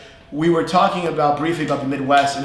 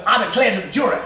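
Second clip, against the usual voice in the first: put-on Southern accent; 6 semitones higher, faster than usual. Teacher acting out addressing a jury, Southern accent. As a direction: play a Southern lawyer, hammed up. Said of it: "you say things like".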